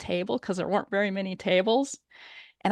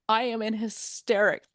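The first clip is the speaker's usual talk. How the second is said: ordinary delivery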